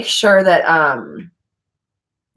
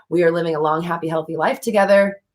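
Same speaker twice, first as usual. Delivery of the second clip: ordinary talk, nothing marked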